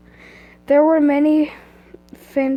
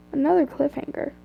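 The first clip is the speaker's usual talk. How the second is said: ordinary delivery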